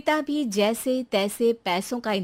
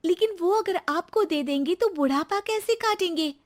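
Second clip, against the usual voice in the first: put-on much higher young wife's voice, pleading; pleading daughter-in-law; 9 semitones higher than usual. Daughter-in-law pleading to spare her retired father, higher pitched.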